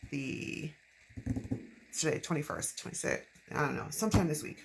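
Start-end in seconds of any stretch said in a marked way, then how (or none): none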